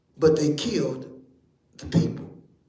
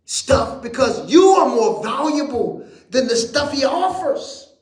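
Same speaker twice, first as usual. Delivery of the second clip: high, loud preacher's call; full-force preaching; 8 semitones higher, 3 dB louder than usual. Acted. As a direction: preach it up to the rafters.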